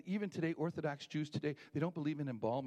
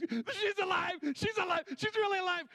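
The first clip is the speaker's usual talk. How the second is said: much higher and louder, ecstatic; joyful shout; 7 dB louder than usual. Retold or imitated. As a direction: scream it out with joy. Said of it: "jumping for joy".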